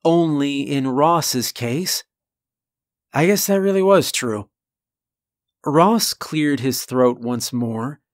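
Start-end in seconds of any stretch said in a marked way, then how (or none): none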